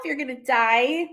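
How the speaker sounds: ordinary talk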